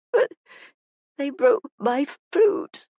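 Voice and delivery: put-on high, halting tearful child; slow, broken, high-pitched grief; crying. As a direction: choke the words out slowly between sobs. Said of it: "G was crying unashamedly now".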